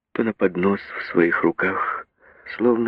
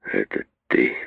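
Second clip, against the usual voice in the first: put-on much lower, slurred drunken mumble; blackout drunk; 12 semitones lower, 5 dB quieter, slower than usual. A narrator's reading. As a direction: slur it, tongue barely moving. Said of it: "Пробормотал он невнятно, еле ворочая языком".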